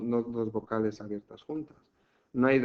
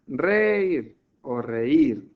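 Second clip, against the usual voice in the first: Reír stretched out loudly, leaning on the í; stress on the í; 7 dB louder, much slower than usual. Shown as a demonstration. Teacher showing pupil where the tonic falls, stretched stress on Reír. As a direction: draw out "Reír", loud on the í. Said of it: "¿Nosotros cómo decimos?".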